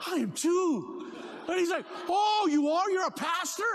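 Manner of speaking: high, loud thrilled exclamation; two pastors thrilled to meet; excited shout. Retold or imitated. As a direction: burst out with delight. Both pastors, excited exchange.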